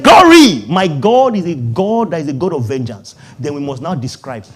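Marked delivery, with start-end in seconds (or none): 0.0-0.7 a loud, high call of glory; shouted glory. Shown as a demonstration. Preacher urging the congregation, shouting glory. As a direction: cry it out to the congregation. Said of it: "you say".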